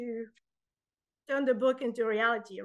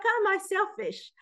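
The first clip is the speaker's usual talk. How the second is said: indignant retort; 7 semitones higher than usual. Retold or imitated. Retold indignant reply.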